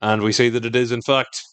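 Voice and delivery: ordinary talk, nothing marked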